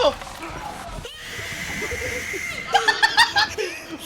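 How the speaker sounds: shrieking burst of laughter. Real, not acted. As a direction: howl with laughter. Big laugh at game moment.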